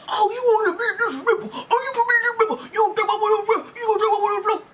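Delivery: high, mocking voice with laughter; laughing mimicry of a rude customer. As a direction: sneer it in a high put-on voice while laughing. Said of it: "And he was like".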